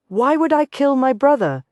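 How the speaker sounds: a hurt brother hurls it back; loathing. A narrator's reading. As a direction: spit it out with contempt. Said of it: "loathing laced through his voice".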